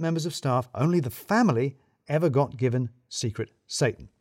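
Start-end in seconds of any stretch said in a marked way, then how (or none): none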